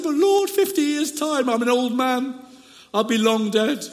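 high, hammed-up tearful wail; mock crying. Old Dutchman lamenting to God, hammed-up crying. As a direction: whine it like a pretend sob, pitched high. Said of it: "But then he started to cry".

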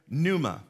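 "pneuma" starting straight on N; silent P. Shown as a demonstration. Pastor points back to that Greek word, "pneuma" with silent P. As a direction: say "pneuma" as NOO-muh. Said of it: "the P in this word is silent".

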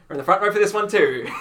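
laughing as the words burst out, loud and fast; cracking up while telling it. Retold or imitated. Laughing at the front-row seats.

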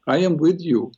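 usual speaking voice

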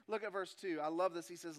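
ordinary talk

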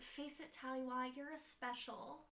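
soft, slowing retold farewell; quiet, drawn-out last words, acted lightly; passing out. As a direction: imitate someone fading out of consciousness. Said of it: "like, passing out".

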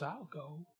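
David volunteering, soft and sure; quiet resolve. Acted. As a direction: say it almost under your breath, but certain. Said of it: "David boldly steps up".

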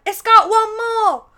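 high, loud, slow put-on rocker voice; hammed-up rocker brag. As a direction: boast it like a dim rock guitarist. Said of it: "Or Spinal Tap?".

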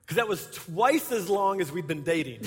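ordinary talk, nothing marked

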